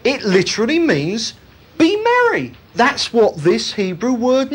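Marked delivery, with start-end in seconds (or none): 1.8-2.5 merry and bright. Shown as a demonstration. Preacher acting out merriment.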